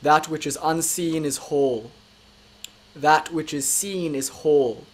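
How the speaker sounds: solemn recitation. Acted. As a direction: read it like a quiet blessing.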